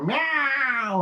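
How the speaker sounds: high mock cat meow; imitated meow. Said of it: "he's crying, I go".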